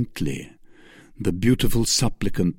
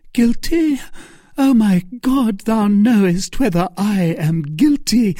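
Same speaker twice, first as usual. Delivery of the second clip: anguished pleading; 10 semitones higher, 4 dB louder than usual. Acted. Milady protesting innocence.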